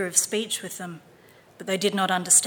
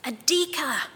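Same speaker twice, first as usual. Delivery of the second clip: usual speaking voice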